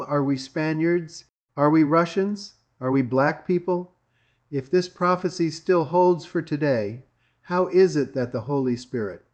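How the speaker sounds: ordinary delivery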